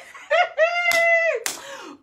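high, loud squeal of laughter; high laughing shout; shrieking laugh. Real, not acted. Laughs after Portable quote.

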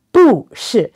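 "不" and "是" each drop sharply from high; two falling tones. Shown as a demonstration. Teacher showing the hard way, "不是" as two falls. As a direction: punch "不" down, then "是" down too. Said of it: "where if you try".

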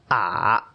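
"ah" dipping down then back up; third tone. Shown as a demonstration. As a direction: let "ah" fall and climb again evenly. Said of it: "goes down and back up again".

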